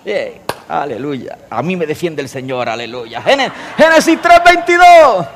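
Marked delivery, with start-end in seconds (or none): 3.2-5.3 higher, louder, slowed reference; loud slow announcement through laughter; emphatic. Real, not acted. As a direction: stretch out the scripture reference. Third try at reference, laughing.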